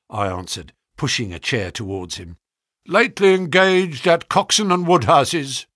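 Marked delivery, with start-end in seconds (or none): 2.9-5.7 loud, higher-pitched businesslike query; brisk, sharp. A narrator's reading. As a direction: question him like a busy man. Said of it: "spoke sharply".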